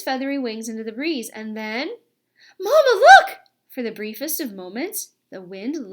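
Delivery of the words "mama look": high, loud squeal of joy; excited squeal. A narrator's reading. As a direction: shout out in delight. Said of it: "he squeaked".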